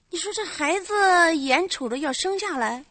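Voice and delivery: ordinary talk, nothing marked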